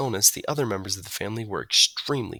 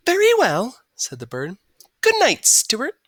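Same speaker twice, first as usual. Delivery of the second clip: sweet bird good night; 13 semitones higher, 7 dB louder than usual. A narrator's reading. Margalo's voice.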